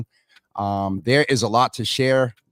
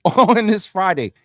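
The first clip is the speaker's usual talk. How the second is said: laughing; 9 semitones higher, 6 dB louder than usual. Real, not acted. Laughs at own slip.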